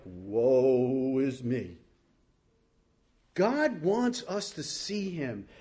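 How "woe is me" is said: low, very slow mock lament; mock woe. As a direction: droop it out like a hammed-up mourner. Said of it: "But we frame it like".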